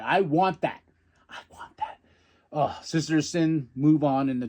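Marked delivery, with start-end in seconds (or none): 1.3-2.0 breathy whispered craving. Retold or imitated. Fan whispering his wish for the titles, whispered.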